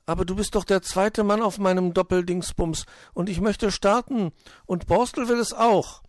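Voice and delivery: high, loud eager argument; insistent hare. Acted. Hoppel wants his partner to start, insistent and high. Hoppel voiced arguing with Willi.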